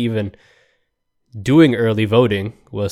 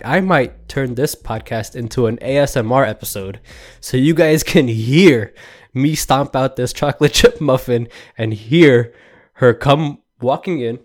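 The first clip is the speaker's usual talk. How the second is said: usual speaking voice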